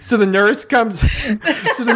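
high, laughing restart; talking through laughter. Real, not acted. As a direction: try to carry on while cracking up. Cracks up while restarting story.

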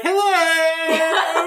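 high tipsy greeting; drunk party hello. Retold or imitated. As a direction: sing out hello, tipsy and grand. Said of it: "paraded in and was like".

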